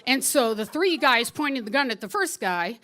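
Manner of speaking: ordinary talk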